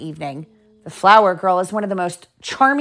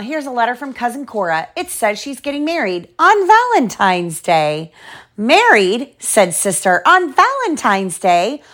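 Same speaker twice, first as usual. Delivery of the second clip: lively storybook reading; reading to children; 2 semitones higher than usual. Acted. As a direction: read it like bedtime story. Reads picture book aloud.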